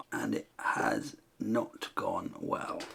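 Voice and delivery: low, slow, soft and resigned; glum verdict. Real, not acted. Painter admits the picture has gone badly, glum. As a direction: admit defeat slowly and quietly. Rueful verdict as time runs out.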